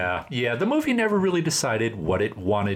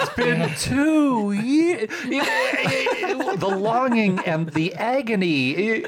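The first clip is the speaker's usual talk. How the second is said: slow, high, overwrought lament; mock melodrama; 9 semitones higher, slower than usual. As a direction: ham up the tragic longing. Mocking melodrama of the curse.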